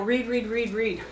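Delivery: ordinary talk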